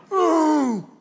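a hammed-up lion moaning ooh; lion's moan. Preacher mimics a lion calling at the water hole, hammed-up moan. Pastor imitates lion's low call.

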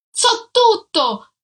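high, loud and slow shout; furious jealous husband shouting at his wife. A narrator's reading. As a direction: hurl the accusation at her.

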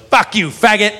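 spat-out insult in a put-on drunk voice; drunk woman's insult. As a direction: sneer it like a drunk. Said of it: "which was".

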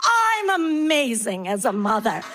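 slow, put-on storyteller voice; self-satisfied storytelling mom. Mom doing voices for her kids, self-satisfied. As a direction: draw it out, sliding downward in self-congratulation. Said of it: "use your voices".

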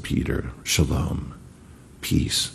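hushed, slow whispered greeting; gentle whisper. Acted. Risen Jesus greets Peter tenderly, in a hushed whisper. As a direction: breathe the words softly, like a blessing.

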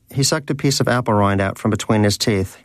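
ordinary delivery, nothing marked

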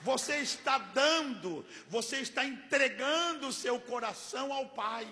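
loud, rhythmic pulpit preaching; full-force preaching. Acted. Pastor preaching to the congregation about God's gifts, loud and driving. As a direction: hammer each "Ele nos deu" to the congregation.

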